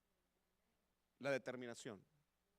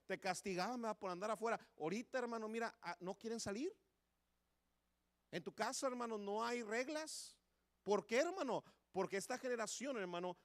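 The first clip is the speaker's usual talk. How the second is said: higher, louder scolding sermon; heated preaching; 7 semitones higher, 5 dB louder than usual. Acted. Preacher scolding parents about today's youth, higher and louder. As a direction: rail against today's lax homes.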